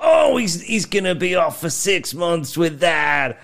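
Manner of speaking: high, loud commentator call; wrestling commentator. Retold or imitated. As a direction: call the move like an over-excited ringside commentator. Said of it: "like, fucking commentator on all the moves".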